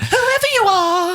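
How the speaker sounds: squeaky high voice. Pretend. Mock Mario squeaky voice.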